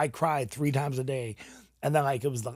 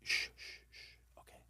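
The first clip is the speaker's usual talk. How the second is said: whispered shush, soft and calming; soothing shush; 16 dB quieter, slower than usual. Retold or imitated. Nurse soothing a crying patient, whispered shush. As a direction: hush him gently like a nurse.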